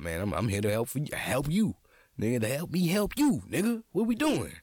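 ordinary talk, nothing marked

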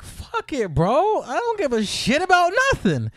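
high-pitched devil-may-care outburst; reckless bravado. Retold or imitated. Storyteller recalling deciding to volunteer, high reckless bravado. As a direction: throw caution away in a high voice.

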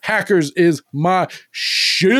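loud, high, drawn-out boast; retold fanboy excitement; hyped declaration. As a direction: crow it slowly, stretching the last word. Retelling own excited reaction.

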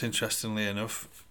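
ordinary delivery

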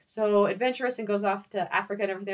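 ordinary talk, nothing marked

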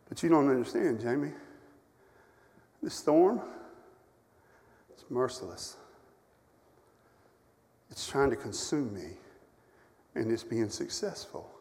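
a sick man admitting defeat; hushed despair. Retold or imitated. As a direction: let the words fall away. Preacher voices his own despair softly.

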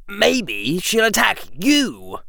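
put-on a sly young boy plotting against a rival; Draco voice.

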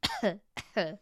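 forced fake cough. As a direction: cough on purpose, staged. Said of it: "That was a fake cough".

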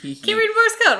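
high, loud mock whine; high mock exclamation. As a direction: exaggerate it like a pouty complaint. Playful self-mocking outburst.

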